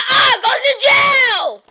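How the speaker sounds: high, loud pretend shout; shouted order. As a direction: yell it out, dragging the words. Officer breaks up a fight.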